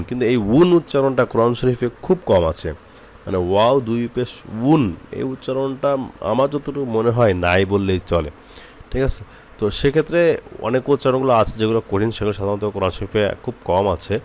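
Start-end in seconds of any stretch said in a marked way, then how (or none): none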